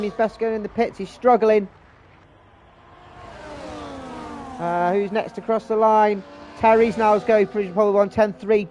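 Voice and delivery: brisk race commentator voice; live play-by-play. Acted.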